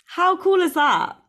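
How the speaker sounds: high, slow, thrilled; how cool is that, gleeful; excited. Real, not acted. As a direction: squeal it with delight. Delighted at Italy's chances.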